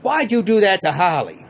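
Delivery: hammed-up confronting woman; mock confrontation. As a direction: call him out. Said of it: "she said".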